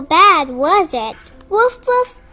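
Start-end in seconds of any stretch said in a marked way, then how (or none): 1.5-2.2 high woof woof; puppy bark. Acted. Reader barking for Biscuit after the shot, high woof. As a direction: yip like a brave puppy.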